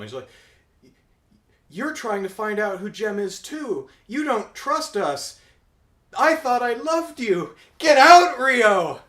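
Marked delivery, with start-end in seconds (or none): 1.7-9.0 high, hurt accusation building to a loud yell; acted angry outburst. Girlfriend throwing her snooping boyfriend out, mock furious yell. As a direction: mimic a betrayed girlfriend, voice climbing into a shout.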